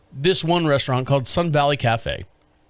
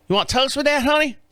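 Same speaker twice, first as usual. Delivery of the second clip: waitress voice; 10 semitones higher than usual. Retold or imitated. Voicing the waitress.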